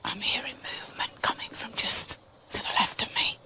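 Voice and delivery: tense whisper in the dark; scared hushed whisper. Real, not acted. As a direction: breathe it so nothing hears you. Alone in dark woods, ghost hunting.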